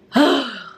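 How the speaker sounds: long weary sigh; tired sigh. Real, not acted. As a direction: let out a heavy sigh. Tired sigh mid-vlog.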